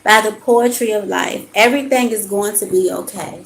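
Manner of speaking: measured, emphatic reading of card text. Acted. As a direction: read the card like a gentle sermon. Reads oracle card aloud.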